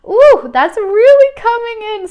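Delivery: real rush of excitement; excited reaction. Real, not acted. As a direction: exclaim as it suddenly hits you.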